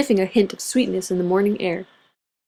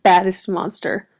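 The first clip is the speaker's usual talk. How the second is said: ordinary delivery, nothing marked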